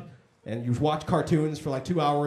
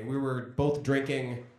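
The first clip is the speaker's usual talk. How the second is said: usual speaking voice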